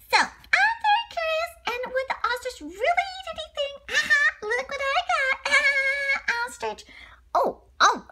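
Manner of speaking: very high, playful monkey voice; squeaky curious little monkey; high George voice. Acted. George wondering about the ostrich, very high voice.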